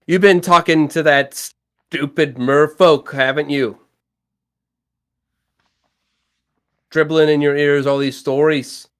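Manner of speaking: put-on loud, slow, grumbling Iwi accent; accusing sailor accent, slowed and loud; Iwi's accent. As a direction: berate him in that odd Iwi accent. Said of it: "I don't know why I have an accent for Iwi now".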